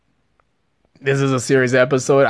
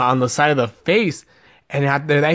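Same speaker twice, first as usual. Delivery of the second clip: usual speaking voice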